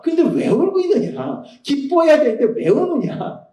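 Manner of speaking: high, pleading voice of Jesus asking why she weeps; voicing Jesus. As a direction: lift the voice up high, coaxing. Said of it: "라고 예수님이 말씀하신다는 거예요".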